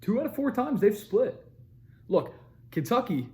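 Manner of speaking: ordinary delivery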